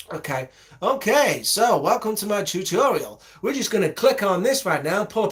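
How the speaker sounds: high, loud parody of a YouTuber; mock tutorial host. Mocking another tutorial video, high and loud. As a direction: ham up the cheery tutorial intro. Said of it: "another fucking YouTube tutorial".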